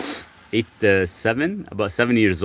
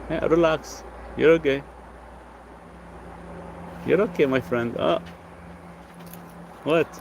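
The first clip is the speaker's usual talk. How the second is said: cooing pet talk; 7 semitones higher, 5 dB quieter than usual. Real, not acted. Coos to dog, higher pitch.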